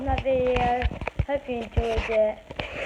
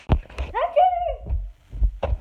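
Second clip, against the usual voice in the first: very high squealing mock sneeze; big fake sneeze; 5 dB louder than usual. Shown as a demonstration. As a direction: sneeze so hard you fall over. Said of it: "The fall over one".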